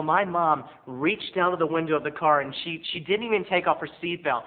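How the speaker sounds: usual speaking voice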